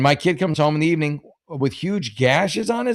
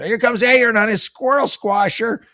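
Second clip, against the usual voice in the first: put-on teasing kids calling out; 9 semitones higher, 5 dB louder than usual. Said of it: "It goes".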